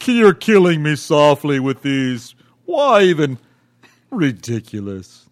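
loud, drawn-out wail of dismay; mock anguish. Host protests being killed by co-host, in loud mock anguish. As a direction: lament it loudly and slowly, hammed up.

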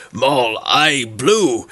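slow, drawn-out lumbering giant voice; dim giant. Acted. Game master plays a giant squabbling over tic-tac-toe, dim giant voice. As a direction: stretch the words like a slow-witted giant. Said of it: "you hear, uh, one say".

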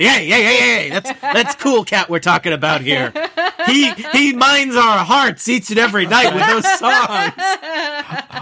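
loud, high-pitched hammed-up protest; rowdy mock indignation from adoring fans; miners defending their idol. Miners scolding a surly miner about Cool Cat, hammed-up indignation. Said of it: "four miners elbow that one surly miner and say".

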